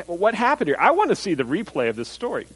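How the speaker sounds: normal talking voice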